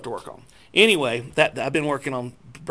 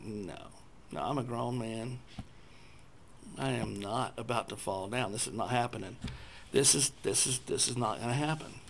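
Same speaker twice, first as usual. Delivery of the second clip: quiet; 4 semitones lower, 11 dB quieter than usual. Retold or imitated. Resisting falling, inner voice.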